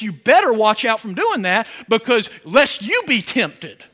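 loud, driving sermon voice; preaching at full force. Acted. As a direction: push each warning out to the pews. Preacher warns the congregation.

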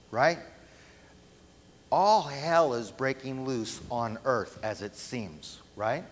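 ordinary delivery

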